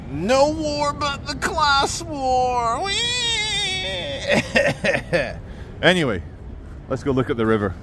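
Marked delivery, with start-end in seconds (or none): 0.1-2.8 very high, very slow sneering voice; mocking slogan. Mocking sticker slogan in put-on voice. 2.8-5.4 high squealing whee into laughter; mock glee. Mock glee after slogan.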